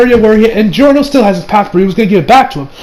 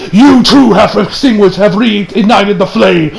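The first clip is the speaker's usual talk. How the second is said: slow, portentous stand voice; drawn-out theatrical proclamation; spirit voice; slower than usual. Acted. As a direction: pronounce it like an otherworldly judge. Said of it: "He's like".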